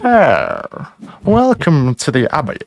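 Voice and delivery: lightly posh English host; a hint of upper-class welcome. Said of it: "you get to do your posh voice".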